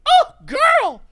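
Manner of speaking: high flirty coo. Retold or imitated. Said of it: "I'm like".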